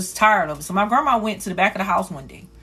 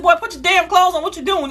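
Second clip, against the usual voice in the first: scolding yell; 9 semitones higher than usual. Retold or imitated. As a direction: bark at him to get dressed. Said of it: "I said".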